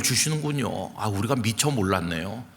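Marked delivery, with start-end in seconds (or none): none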